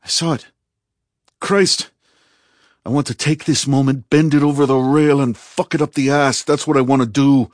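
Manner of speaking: loud, high, crude exultation; wild, over-excited outburst; excited raving. A narrator's reading. Ari raving over the disaster.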